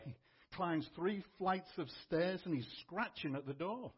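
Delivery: normal talking voice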